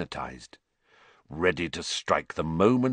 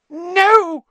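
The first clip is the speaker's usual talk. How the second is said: high squealing cry of terror; terrified shriek; 9 dB louder than usual. Acted. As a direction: shriek it in panic. Pettigrew panics.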